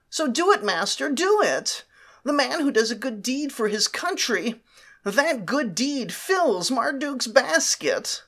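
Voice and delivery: high, eager servant voice; hunchback assistant. Acted. Slave agrees eagerly with master.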